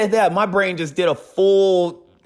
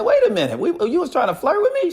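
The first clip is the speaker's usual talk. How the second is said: surprised; 13 semitones higher, faster than usual. Retold or imitated. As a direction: jump up in pitch, caught off guard.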